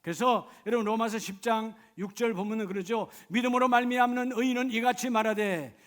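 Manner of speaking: loud, high, fervent preaching; full-force preaching. Acted. Pastor pleading with the congregation, loud fervent preaching.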